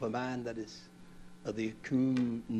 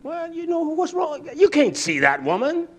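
high, louder mock wife retort; voicing his wife; 13 semitones higher, 9 dB louder than usual. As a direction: play the offended wife, pitched up. Wife's retort voiced high.

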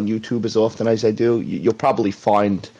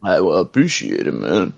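a lightly voiced Stallone mumble, slurred and lower; Sly voice; 4 semitones lower than usual. Shown as a demonstration. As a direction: say thanks like Rocky. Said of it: "you gotta do it in the Sly voice".

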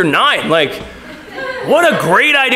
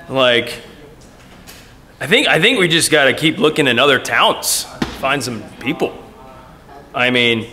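low, quieter voice of discouraged doubt; tempted doubting flesh; 8 semitones lower, 3 dB quieter than usual. Retold or imitated. Preacher voicing his own doubting flesh, low and discouraged. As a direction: mutter the hopeless human reaction. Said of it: "my flesh might be tempted to go".

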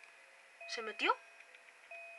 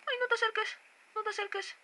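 panicking gamer; 8 semitones higher than usual. Real, not acted. Repeated frightened pleading.

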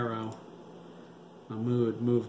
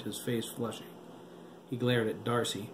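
usual speaking voice

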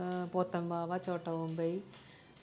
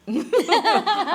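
loud, high burst of laughter; laughing; 10 semitones higher, 8 dB louder than usual. Real, not acted. Guest asked whether she sings, laughing loudly.

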